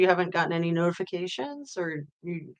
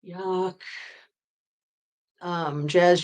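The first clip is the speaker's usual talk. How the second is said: normal talking voice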